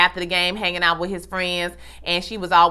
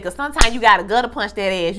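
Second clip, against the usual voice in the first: ordinary talk, nothing marked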